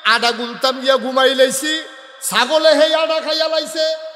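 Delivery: high, loud sustained wail of a sermon; full-force preaching. Acted. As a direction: belt it out with drawn-out line endings. Full-force melodic preaching as Ayesha. (ওয়াজের টানা সুরে উঁচু গলায় চিৎকার)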